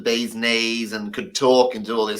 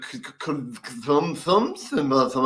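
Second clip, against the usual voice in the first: 1 stuttering attempt; 4 dB quieter, slower than usual. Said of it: "And I'm going".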